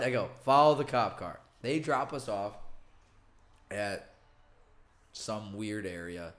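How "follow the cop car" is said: loud, impatient repeat; exasperated repeat. Retold or imitated. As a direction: say it again, louder and fed up. Repeating to confused Uber driver.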